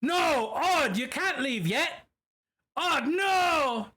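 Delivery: high, loud, drawn-out mock dismay; playful protest. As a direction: cry out in hammed-up dismay as a friend leaves. Protests a viewer leaving the stream.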